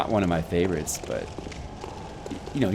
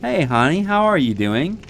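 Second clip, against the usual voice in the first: sweet high greeting; 9 semitones higher, 5 dB louder than usual. Real, not acted. Greeting girlfriend on call.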